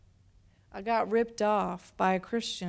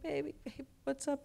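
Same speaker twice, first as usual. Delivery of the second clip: put-on higher, softer soothing to a toddler; soothing mom; 6 semitones higher, 6 dB quieter than usual. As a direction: gently coax a crying child.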